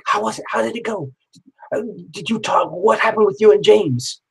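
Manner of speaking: put-on excited girlfriend. Girlfriend quizzing him after meeting the psychic, mildly eager. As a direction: bubble over with a hint of curiosity. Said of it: "She was like".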